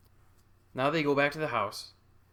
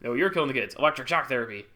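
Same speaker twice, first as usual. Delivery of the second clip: louder accusation in natural pitch; interrogating doctor brother; 5 dB louder than usual. Acted. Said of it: "interrogated by her doctor brother".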